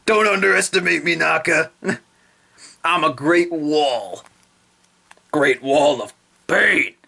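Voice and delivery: slow, confident put-on voice; Shokaku's voice. Shokaku reassuring Naka, slow boast. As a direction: boast calmly. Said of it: "but Shokaku's like".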